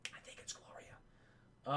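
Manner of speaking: slow, soft whisper to himself; whispered aside. Real, not acted. Streamer whispers while checking the character's name, slow and hushed.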